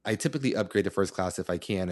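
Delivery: ordinary delivery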